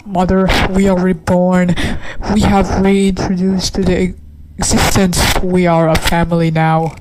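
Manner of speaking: loud grand pronouncement; the creature proclaims to its mother, loud and grand; solemn proclamation. Acted. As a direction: announce the rebirth like a ritual. Voicing reborn creature family.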